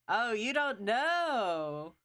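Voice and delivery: slow drawn-out teasing. Shown as a demonstration. Teasing the player who doesn't know, drawn out slowly. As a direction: drag out you don't know teasingly.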